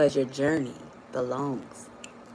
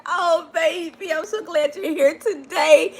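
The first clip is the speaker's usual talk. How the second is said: tender welcome; 7 semitones higher, slower than usual. Real, not acted.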